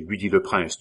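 ordinary delivery

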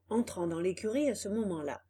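ordinary delivery

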